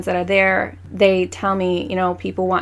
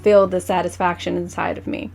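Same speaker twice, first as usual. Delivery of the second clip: usual speaking voice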